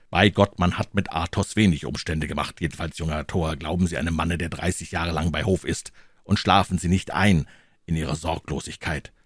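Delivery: plain reading voice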